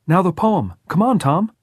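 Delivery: put-on high, loud, eager boy; frantic boyish insistence; excited urging. A narrator's reading. Frantic boy urges Tom on.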